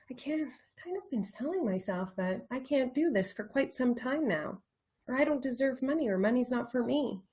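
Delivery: soft, inward confession of doubt; quiet self-doubt. Acted. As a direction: murmur it to yourself as a realisation. Acts out quiet limiting self-talk.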